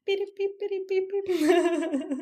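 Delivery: high, mock telegraph beeping; radio beeps. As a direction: beep like an old radio signal. Mock old radio signal.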